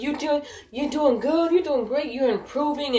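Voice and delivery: high-pitched, peppy praise; imitated encouraging supervisor. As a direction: mimic a boss piling on compliments.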